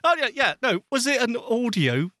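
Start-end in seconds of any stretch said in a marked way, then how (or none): none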